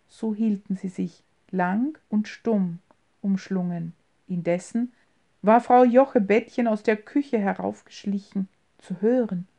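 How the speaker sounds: ordinary delivery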